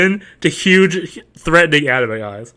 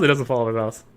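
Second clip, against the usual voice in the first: low mock repeat; 5 semitones lower, 8 dB quieter, faster than usual. Streamer echoing his own complaint, low and soft. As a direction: lower and much softer.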